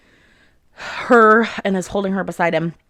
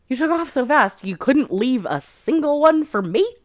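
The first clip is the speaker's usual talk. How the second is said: higher-pitched mock grumble; teasing complaint; 8 semitones higher than usual. Garrick complains on arriving at the rescue, teasing complaint. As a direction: playfully protest being left out.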